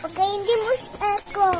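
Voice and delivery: ordinary talk, nothing marked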